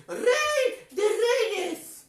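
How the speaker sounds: very slow, drawn out word by word; slow reading. Shown as a demonstration. Clown reads the king's belt slowly to children. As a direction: spell it out slowly for the kids.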